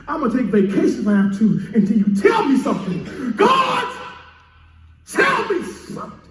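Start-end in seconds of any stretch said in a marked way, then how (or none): 3.4-6.2 high pleading call, much higher; high cry to God. Acted. Preacher pleading with God, high crying call. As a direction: cry it up to heaven.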